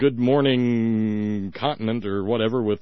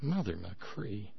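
exasperated sigh; 4 semitones lower, 14 dB quieter, much slower than usual. Real, not acted.

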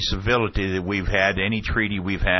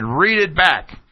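high, raised retold order; Ashcroft demanding; 9 semitones higher, 4 dB louder, slower than usual. Official ordering the questioner, imitated raised demand. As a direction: imitate a heated command, pitched up. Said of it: "Ashcroft said".

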